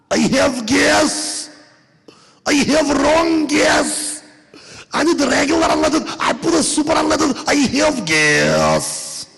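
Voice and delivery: put-on high, slow, frantic Russian accent wail; panicked Russian accent. Russian woman bursting into the shop, panicked Russian accent.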